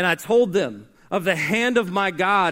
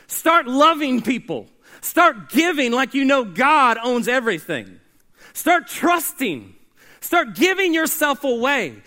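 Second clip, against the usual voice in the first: higher and louder, each command punched; rousing exhortation; 5 semitones higher, 4 dB louder than usual. Acted. Preacher urging the church to get to work, loud and high. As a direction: preach it with rising fire.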